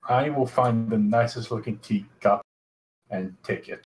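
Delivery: ordinary talk, nothing marked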